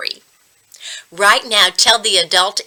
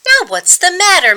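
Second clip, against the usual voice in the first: much higher, quick impatient question; fed-up teacher demanding an answer; exasperated; 10 semitones higher, 3 dB louder, faster than usual. Acted. Teacher exasperated at sulking boy.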